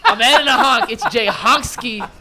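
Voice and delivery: gleefully stubborn, loud and drawn out; laughing defiance. Real, not acted. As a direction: insist playfully through laughter, stretching the nickname. Playful defiance after being corrected.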